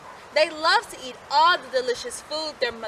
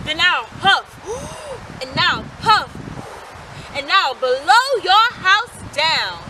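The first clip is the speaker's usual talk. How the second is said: louder wolf threat, slowing at the end; wolf crying out; 5 semitones higher, 6 dB louder than usual. A narrator's reading. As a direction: threaten with a gasp. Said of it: "cried the wolf".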